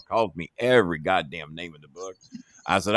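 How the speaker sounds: ordinary talk, nothing marked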